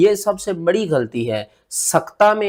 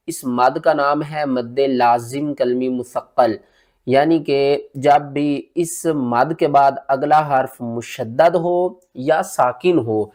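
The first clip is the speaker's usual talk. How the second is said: ordinary talk, nothing marked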